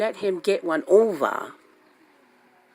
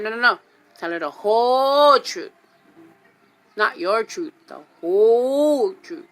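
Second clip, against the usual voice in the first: much louder, slowing on the whole truth; insistent loud order; 4 semitones higher, 9 dB louder than usual. Retold or imitated. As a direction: lean hard on every word.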